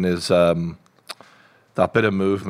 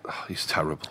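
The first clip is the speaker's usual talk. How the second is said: whispered; 5 semitones lower, 11 dB quieter than usual. Real, not acted. Muttered complaint.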